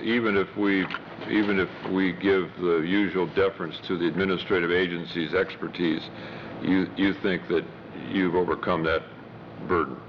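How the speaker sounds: ordinary talk, nothing marked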